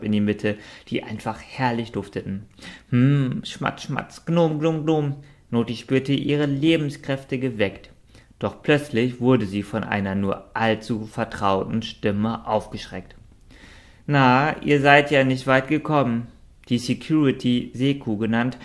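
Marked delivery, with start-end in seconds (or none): 2.9-5.2 genüssliches Schmatzen. Acted. Gnome essen Pommes. 14.1-16.3 höhnischer Wachmann. Acted. Security spricht Gnome an.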